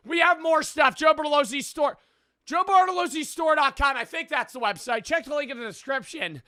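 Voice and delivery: high-pitched, excited promo pitch; hyped merch ad. Real, not acted. Streamer plugging his new merch drop to viewers, hyped. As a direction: sell the merch with bursts of loud hype.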